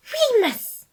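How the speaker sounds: high, loud shocked cry of the name; appalled exclamation. A narrator's reading. Patton appalled at Remus's confession, high loud cry. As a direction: gasp out his name in horror. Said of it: "Patton exclaimed, appalled".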